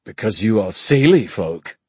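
loud, sneering put-down; scornful jibe; sneered. A narrator's reading. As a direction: sneer it with royal contempt. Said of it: "sneered Oban".

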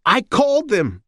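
put-on high shrill old mother voice; high bleating mother; blatting old woman. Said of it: "she blatted".

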